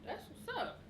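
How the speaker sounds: normal talking voice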